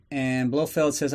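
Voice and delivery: ordinary delivery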